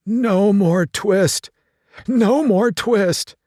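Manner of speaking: put-on a sick old man calling out in a dream; feverish dream mumbling. Ill tailor tossing in bed, crying out in his fever. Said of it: "in his dreams he mumbled".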